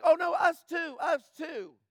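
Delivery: high, loud eager shout of a crowd; mock crowd cheering us too. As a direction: shout it like a crowd swept up in a rally. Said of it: "And they're like".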